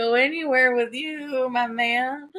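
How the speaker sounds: flirty. Retold or imitated. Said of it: "I was like".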